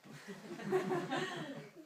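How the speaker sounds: high thin giggle in a put-on voice; playful high-pitched giggle; creaky high giggle. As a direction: giggle like a mischievous creature. Creature giggles again.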